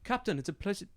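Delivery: polite captain's greeting; NPC greeting. Acted. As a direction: greet her like a fellow officer. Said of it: "she says".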